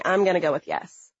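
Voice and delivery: plain reading voice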